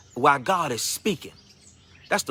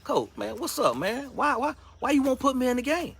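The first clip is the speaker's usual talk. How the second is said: rising, much higher pleading question; asking the coach; 10 semitones higher than usual. Retold or imitated. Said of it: "walked up to the coach and said".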